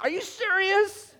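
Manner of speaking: high, loud, drawn-out incredulity; exasperated disbelief. Acted. Listener fed up with pastor's tall story, exasperated.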